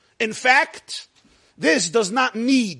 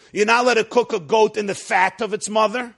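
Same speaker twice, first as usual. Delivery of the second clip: ordinary delivery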